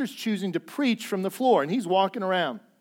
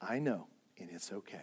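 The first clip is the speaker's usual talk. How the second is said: gentle reassurance; 10 semitones lower, 13 dB quieter than usual. Retold or imitated. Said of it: "And it was like".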